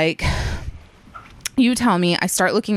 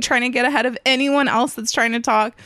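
ordinary talk, nothing marked

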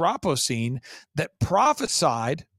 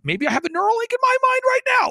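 high, loud, rushed outburst; imagined amazed listener; 6 dB louder, faster than usual. Acted. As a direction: blurt it out fast, voice shooting way up. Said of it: "Someone's saying".

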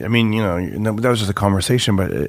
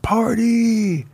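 excited cheer; 10 semitones higher than usual. Retold or imitated. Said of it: "He's like".

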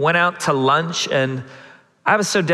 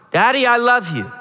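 put-on louder child quote; 7 semitones higher, 3 dB louder than usual. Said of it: "say it louder".

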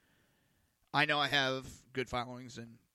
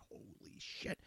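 hushed, nearly whispered retold amazement; stunned awe; 8 dB quieter, slower than usual. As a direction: say it under your breath, stunned. I was stunned by it.

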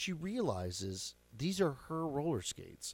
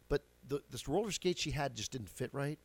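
ordinary delivery

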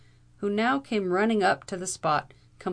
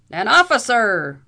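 much louder, high, slow outraged roar; vociferating; 5 semitones higher, 14 dB louder than usual. A narrator's reading. Captain roars in outrage at the bailiff. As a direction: explode at the word officer. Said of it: "vociferated Captain O'Blunderbuss".

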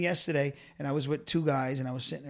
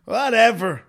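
indifferent shrug; 2 semitones higher, 7 dB louder, slower than usual. Retold or imitated. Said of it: "I'm like".

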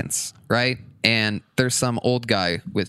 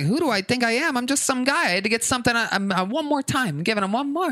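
strained patience; 11 semitones higher, faster than usual. Retold or imitated. Retelling his strained patience.

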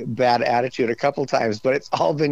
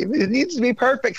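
perfectionist demand; 12 semitones higher than usual. Retold or imitated. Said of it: "I'm like".

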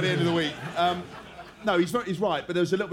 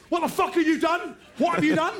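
much higher mock outrage; hammed-up angry coach bursting over; mock furious coach; 11 semitones higher than usual. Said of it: "comes marching over and goes".

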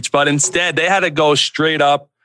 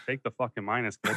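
mild quiet deadpan; 4 semitones lower, 11 dB quieter than usual. Retold or imitated. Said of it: "he just looked at me and said".

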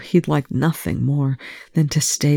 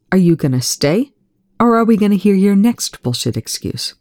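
louder, rising into a higher accusation; bitter challenge; 5 semitones higher, 4 dB louder than usual. A narrator's reading. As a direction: throw the question at him. Eli confronts Young bitterly.

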